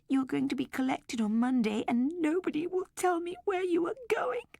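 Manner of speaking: put-on high child's voice, close to tears; tearful little girl. A narrator's reading. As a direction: plead through sobs with her toy.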